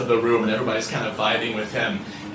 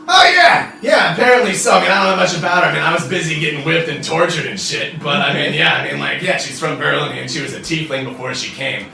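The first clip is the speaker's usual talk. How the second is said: loud high burst settling into casual rambling; excited barfly blab, gradually calming; brash devil officer, eager; 3 semitones higher, 5 dB louder, faster than usual. Acted. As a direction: jump in loudly, then ease into chatter.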